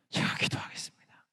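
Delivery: hushed whispered vow. Pretend. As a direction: whisper it humbly to God. Pastor answers God humbly. (속삭이듯 작게)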